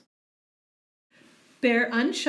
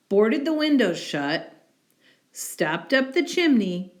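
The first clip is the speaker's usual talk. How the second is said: plain reading voice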